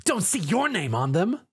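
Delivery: a touch of sassy defiance; barked back. A narrator's reading. Thieving mare talks back to the shopkeeper, lightly defiant. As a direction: toss it back cheekily. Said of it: "she barked back".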